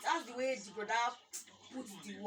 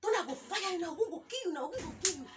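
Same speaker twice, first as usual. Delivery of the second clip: retold angry curse; 6 semitones higher than usual. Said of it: "the lady was like".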